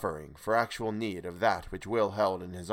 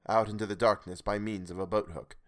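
usual speaking voice

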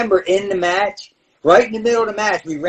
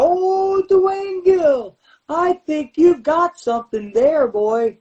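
much higher, put-on creepy undertaker drawl; crooning the name like a spooky manager; Paul Bearer impression; 10 semitones higher, slower than usual. Paul Bearer teasing the young wrestler, high creepy voice. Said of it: "Paul Bearer looked at me and goes".

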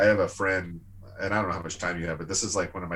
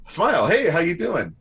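much higher and louder, a bright rehearsed hello; eager friendly greeting; 10 semitones higher, 8 dB louder than usual. Shown as a demonstration. Practising how his friend greets people, hammed-up eager hello. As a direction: try the greeting on like a new trick. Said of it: "let's try it this way".

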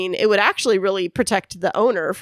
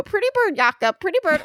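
high squawky parrot imitation; 10 semitones higher than usual. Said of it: "the bird would go".

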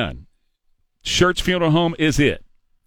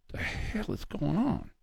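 muttered aside; 13 dB quieter, slower than usual. Real, not acted. Exasperated aside after rant.

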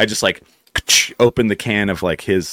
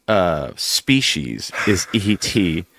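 slow earnest question; much slower than usual. Retold or imitated.

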